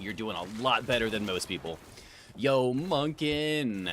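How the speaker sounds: ordinary talk, nothing marked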